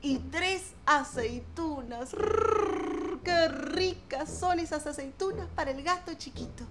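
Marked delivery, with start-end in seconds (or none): 2.1-3.2 higher rolled purr; happy purr. A narrator's reading. Kitten relishes its olives, contented purr. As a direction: purr with delight.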